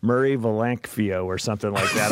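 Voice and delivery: usual speaking voice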